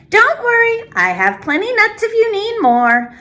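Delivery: put-on high, bright old grandmother voice; old woman greeting a visitor at her door; Baba Brina greeting. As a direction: welcome the guest like a kind old granny.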